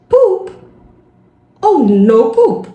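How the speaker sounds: Poop called out high and loud, then dragged slowly. Retold or imitated. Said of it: "mais eu falava mais alto".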